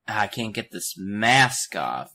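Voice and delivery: slow, loud, strained imitation; mock struggling. Girl pulling at stuck mask, mock straining. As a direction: drag out the words as if tugging hard.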